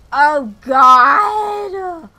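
a sudden loud cry of alarm; a loud, startled scream at the screen; real panicked shout. Real, not acted. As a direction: yell it out in real fright. Sim catches fire again.

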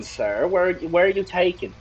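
normal talking voice

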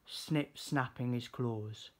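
usual speaking voice

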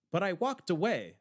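plain reading voice

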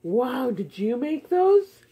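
ordinary talk, nothing marked

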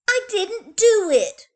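put-on high, loud childish protest; little girl denial. A narrator's reading. Little girl insisting to her siblings, high and loud. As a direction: squeak out a guilty denial.

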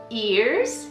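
ordinary delivery

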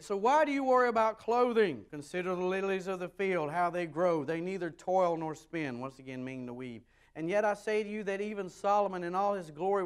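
building, high-pitched Bible proclamation; emphatic scripture reading. Real, not acted. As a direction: proclaim the verse with force. Reads scripture aloud to congregation.

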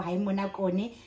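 ordinary talk, nothing marked

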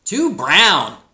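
high, loud squawked verdict; rooster squawk. A narrator's reading. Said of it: "squawked Rooster".

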